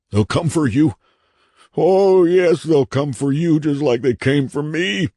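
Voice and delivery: high, loud, crazed warning; haunted man, raving. A narrator's reading. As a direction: warn the visitor with mad certainty.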